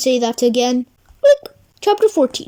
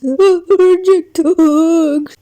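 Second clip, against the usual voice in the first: drawn-out wailing Allergic; slow shocked wail; slow dramatic; slower than usual. Acted. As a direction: stretch it out in dismay. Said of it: "Allergic to hugs, eh?".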